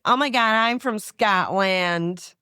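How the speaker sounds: a Scot imitating a Pittsburgh local's American accent. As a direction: put on a slow, gushing American accent. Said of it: "That's my American accent".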